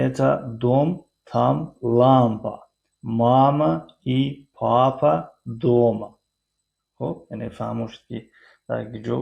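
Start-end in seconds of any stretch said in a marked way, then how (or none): 3.0-6.1 "мама и папа дома" said very slowly; slow model sentence. Shown as a demonstration. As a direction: spell the phrase out slowly for the class. (ҷумлаи намунавӣ, оҳиста)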